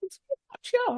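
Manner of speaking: high, breathy mock-flattered girl voice; squeaky mock-bashful thank-you; mock coy thanks. Coy reply to "hello, beautiful [name].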